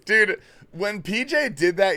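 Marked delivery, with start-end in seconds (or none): none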